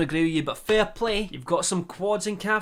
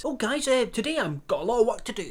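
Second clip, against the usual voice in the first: mock Paul voice; 7 semitones higher, 4 dB louder than usual. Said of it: "he just says".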